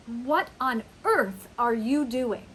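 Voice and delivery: loud, incredulous scolding; appalled parent. Acted. Parent to child coloring walls with permanent markers, appalled and loud. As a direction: hammer EARTH in exasperated disbelief. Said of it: "if your child has permanent markers".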